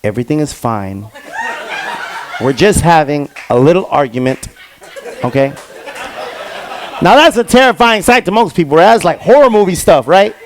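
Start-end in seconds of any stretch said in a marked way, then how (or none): 0.0-5.5 much lower and very soft, eerily calm. Retold or imitated. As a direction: reassure the neighbor as if nothing happened. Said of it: "ever so calmly says".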